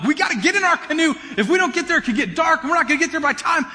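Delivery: high, strained, exasperated call to a lazy buddy; mock exasperated. Pastor replaying yelling at friend Doug at camp, high and exasperated. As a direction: act out the impatient planner snapping at his friend. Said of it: "mad at him".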